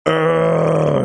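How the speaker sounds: loud high ugh of frustration; frustrated groan. Real, not acted.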